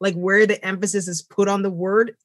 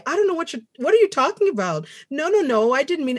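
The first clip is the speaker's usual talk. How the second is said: higher, flustered protest, mock-defensive; defensive denial; 7 semitones higher, faster than usual. Imitating a dominant English speaker brushing off a racism remark, flustered and higher. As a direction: act out a flustered denial, pitched up. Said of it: "they respond and say".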